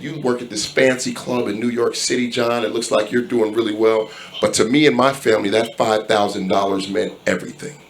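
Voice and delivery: soft, low, subdued imitation of tearful gratitude; near tears. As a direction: quietly imitate a man close to crying. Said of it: "about to start crying".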